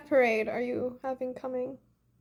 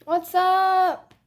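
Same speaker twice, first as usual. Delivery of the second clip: bright greeting; 7 semitones higher, 6 dB louder than usual. Real, not acted. Greeting fans on live stream.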